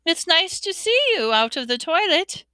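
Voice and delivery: high-pitched forced cheer; falsely bright. A narrator's reading. Hermione making small talk with a ghost, falsely bright. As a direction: make pleasantries too brightly. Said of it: "in a falsely bright voice".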